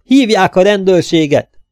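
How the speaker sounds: loud, high call for help; shouting. A narrator's reading. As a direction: yell down the corridor for the police. Neighbour calling for police. (kiabálva segítséget hív)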